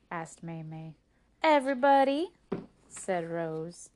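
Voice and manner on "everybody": high, loud, emphatic retort; taunting. Acted. Rose telling sister who finds her funny, taunting. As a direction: fling it back at a sister.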